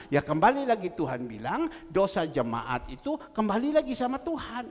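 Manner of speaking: loud, driving preacher delivery, rising to the peak; full-force preaching. Acted. Pastor preaching loudly to his congregation about sin. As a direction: hammer the point home from the pulpit.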